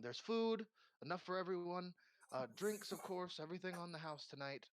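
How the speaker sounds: high-pitched bustling hostess; frantic innkeeper. Acted. Innkeeper frantically serving the returning party, bustling and high. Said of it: "frantically going around".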